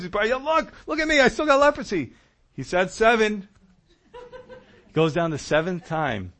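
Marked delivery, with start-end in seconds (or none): 0.3-2.1 very high-pitched mock protest; exasperated. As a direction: play a frustrated man squealing his complaint. Imagines Naaman exasperated after six dips.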